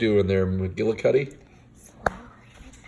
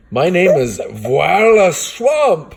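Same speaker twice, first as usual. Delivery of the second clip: slow, high, loud witchy growl; a slow shouted witch voice, much higher; scratchy witch voice; 8 semitones higher, 4 dB louder, slower than usual. Acted. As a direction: boom it out like a mean witch teacher. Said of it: "said the lady in the scratchy voice".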